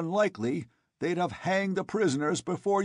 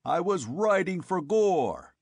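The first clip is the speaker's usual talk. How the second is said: roared; 8 semitones higher than usual. A narrator's reading. Conan roaring down to his men from the cliff. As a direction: bellow it out at the men. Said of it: "he roared".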